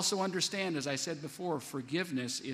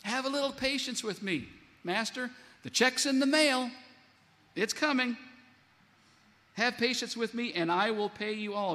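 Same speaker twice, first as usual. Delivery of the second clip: mock pleading debtor; 7 semitones higher than usual. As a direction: whine the excuses like a cornered debtor. Preacher acts out pleading debtor.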